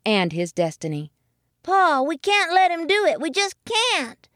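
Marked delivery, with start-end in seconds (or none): 1.6-4.3 high, small imploring voice; pleading child. A narrator's reading. Young muskrat begging dad to intervene, high pleading voice. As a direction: beg like an upset little one. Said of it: "Jimmy Bobby pleaded".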